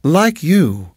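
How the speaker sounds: a hint of a doting hostess; sweet landlady. A narrator's reading. Landlady voiced by narrator.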